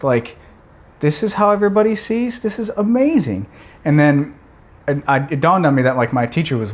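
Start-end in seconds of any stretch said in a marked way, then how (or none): 1.0-3.5 high, awed wonder; amazed. Retold or imitated. Boy with new glasses marvels at the clock. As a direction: gasp at seeing clearly for the first time. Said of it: "just being amazed".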